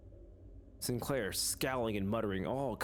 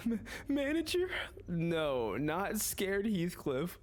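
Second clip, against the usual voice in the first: much higher, stammering frightened voice; timid Sinclair; 7 semitones higher, slower than usual. Acted. Sinclair timidly calling to the manager, frightened high voice. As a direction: squeak it out like a scared boy. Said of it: "how I just hear Sinclair in my head".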